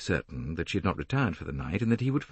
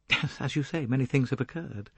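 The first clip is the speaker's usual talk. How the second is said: higher voice with a touch of bitterness; hard, bitter; 6 semitones higher than usual. A narrator's reading. Young woman echoes the detective, hard undertone of bitterness. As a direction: echo him with restrained, hard bitterness. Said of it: "Her voice was hard with an undertone of desperate bitterness".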